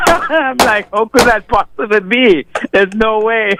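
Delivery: high and giggly through the words; laughing. Real, not acted. Guest mocks callers' claims, in amused laughter. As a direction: laugh your way through the disbelief.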